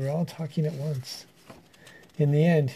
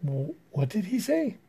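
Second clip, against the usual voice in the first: astonished; 5 semitones higher than usual. Acted. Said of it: "cried the frogs".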